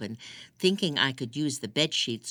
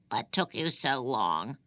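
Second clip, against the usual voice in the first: a lightly rasped crone's question, from the dark; raspy old woman. A narrator's reading. Old prisoner rasps at the girl who kept her waiting, raspy. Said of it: "she said in a raspy voice".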